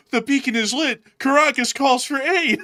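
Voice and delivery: high, loud grand declaration; mock proclamation. Cuban officials announcing the signal, high mock grandeur. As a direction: proclaim it like an epic call to arms. Cuba solemnly declaring alarm.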